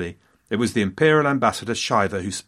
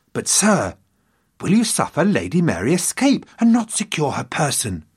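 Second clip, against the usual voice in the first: higher-pitched, dramatic plea to a lord; urgent protesting courtier; 5 semitones higher than usual. Acted. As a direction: act out a supporter challenging his master. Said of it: "was overheard making that very point".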